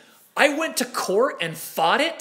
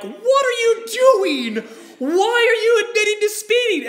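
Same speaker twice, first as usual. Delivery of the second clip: much higher and louder, stunned disbelief; incredulous crowd; 13 semitones higher, 4 dB louder than usual. Retold or imitated. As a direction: voice the whole room gasping at him. Courtroom crowd staring in disbelief.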